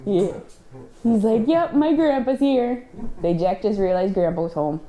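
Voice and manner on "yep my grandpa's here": a hint of a higher, slower dog voice; lightly voiced excited dog; voicing the dog. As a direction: speak lightly for the happy dog. Voices the dog's thoughts.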